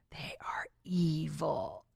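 slow, hushed whisper of a dark secret; mock whisper. Mom friend confiding about daughters, slow whisper. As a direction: whisper it slowly like a confession.

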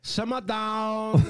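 high, slow mock Chinese accent; drawn-out fake Chinese accent. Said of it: "y'all can't even do a Chinese accent".

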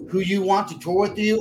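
ordinary talk

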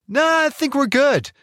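mock casual refusal. Mocking their refusal of rescue.

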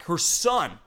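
ordinary talk, nothing marked

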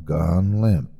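ordinary delivery, nothing marked

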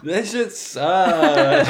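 high, slow, laughing loudly. Real, not acted. Laughing at the quiet synth.

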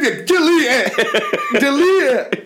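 high, slow, laughing mock plea; begging through giggles to delete it. Telling the teacher to delete the recording, high and laughing. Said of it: "I said".

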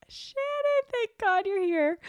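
very high, loud relieved wail; relieved high cry. Retold or imitated. Greeting her friend Shannon with high melodramatic relief.

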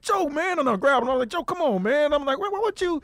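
high, urgent pleading with a friend; plead, pitched high and hurried; retold pleading. Holding Joey back, high urgent pleas to calm him.

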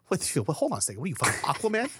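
put-on high incredulous girlfriend voice; mock girlfriend, baffled; mocking disbelief. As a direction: squeak out her disbelief. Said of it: "She's like".

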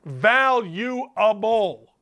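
"Valuable" drawn out very slowly, syllable by syllable; slow for emphasis. Shown as a demonstration. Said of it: "I'll say it slow for the boys in the back".

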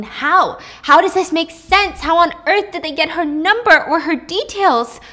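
high, loud panicked questions; a hint of rising panic; panicked disbelief. Panicked inner thoughts.

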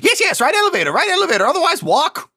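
squeaky little doorman demanding the password. Acted. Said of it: "He's like".

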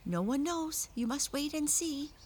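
high-pitched creature reply; high, foreboding wolf voice; wolf's head. A narrator's reading. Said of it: "replied the wolf".

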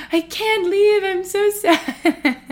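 high, loud, drawn-out whine dissolving into laughter; hammed-up tearful lament, stretching the last word; dramatic mock wail. Vlogger mocking own reluctance to leave Boston, overdramatic wail. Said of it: "I'm so dramatic".

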